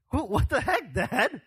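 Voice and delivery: high, loud shocked son shouting at his father; outraged Isaac. Acted. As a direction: cry out in disbelief at dad. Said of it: "what if we were Isaac".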